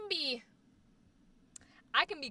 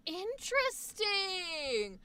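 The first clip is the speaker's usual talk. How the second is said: intrigued; 5 semitones higher, 5 dB quieter, much slower than usual. Real, not acted. Intrigued by friend's two mom modes.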